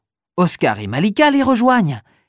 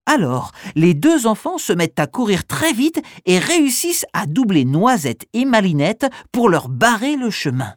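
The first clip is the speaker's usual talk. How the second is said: usual speaking voice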